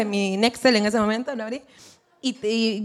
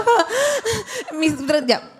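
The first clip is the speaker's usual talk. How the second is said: shocked gasp; 7 semitones higher, much slower than usual. Retold or imitated. Retold shock at call cut.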